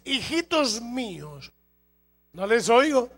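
loud, slow, drawn-out proclamation; forceful scripture reading. Acted. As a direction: preach the verse word by word. Reads scripture aloud at full force. (lectura bíblica proclamada con fuerza)

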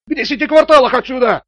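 high, pained yell; crying out. A narrator's reading. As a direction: yell the answer in agony. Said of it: "закричал Раттер". (кричит от боли)

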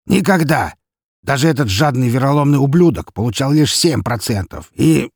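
heated indignation, pushed up in pitch; indignant outburst. A narrator's reading. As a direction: refuse hotly and protest the terms. Boss furiously rejects the terms.

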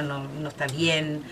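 ordinary delivery

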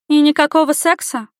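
put-on high, girlish doubt; wary girl. A narrator's reading. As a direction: voice a girl checking the catch. Girl questions the man's offer.

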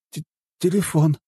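high voice catching on the first sound; frightened stutter. A narrator's reading. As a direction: stammer out the word in fright. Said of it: "затрясся всем телом помощник". (испуганно заикается)

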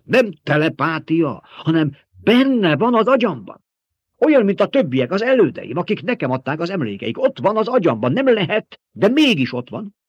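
loud, high-pitched, near-hysterical insistence; frantic protest. A narrator's reading. Old woman explaining the child is inside her mind, frantic. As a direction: cry it out high, as if invaded.

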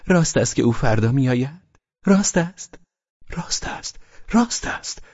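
high-voiced girl pressing for the truth; firm, insistent questioning. A narrator's reading. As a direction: insist on an answer, pitched high. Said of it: "با صدایی محکم پرسید". (با صدایی زیر و مصرانه)

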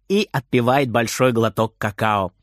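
ordinary delivery

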